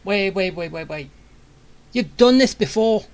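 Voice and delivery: ordinary talk, nothing marked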